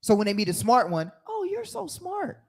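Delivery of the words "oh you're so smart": much higher, soft, slow mock praise. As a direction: coo it like praising a toddler. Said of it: "That's condescending".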